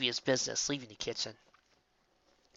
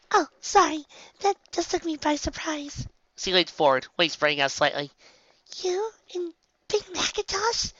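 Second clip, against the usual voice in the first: timid Fluttershy voice. Acted. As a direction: ask shyly, flustered. Fluttershy's surprised timid questions.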